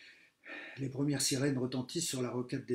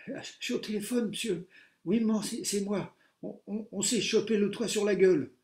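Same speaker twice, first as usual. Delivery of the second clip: high loud breathless babble; excited phone call; 9 semitones higher, 7 dB louder than usual. A narrator's reading. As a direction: gabble down the phone. Pupil on phone to mum.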